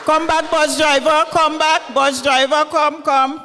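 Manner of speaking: calls loudly after the departing bus driver; loud and carrying, to someone walking away; mother loudly calls the bus driver back; calling out. Acted.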